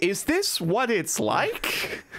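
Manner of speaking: high, slow, amazed freshman; wide-eyed wonder. Retold or imitated. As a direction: drag it out in naive awe. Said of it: "I'm just like".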